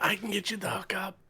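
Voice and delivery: quick conspiratorial whisper. Pretend. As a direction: whisper the offer like a dealer. Offering shady hookup.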